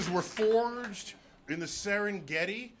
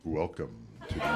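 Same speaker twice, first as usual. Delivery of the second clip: deep, booming planetarium announcer; much faster than usual. Pretend. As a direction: intone it like a planetarium show. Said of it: "my planetarium voice".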